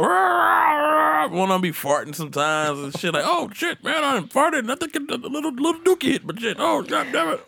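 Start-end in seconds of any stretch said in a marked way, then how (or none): 0.0-1.3 high, shouted "rah rah" racket; raucous high-pitched crowd imitation; loud mock clamor. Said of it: "be loud as hell". 3.3-7.5 high-pitched old-timer impression; comic high-voiced old driver grumbling; old men clowning. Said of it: "They all come in there".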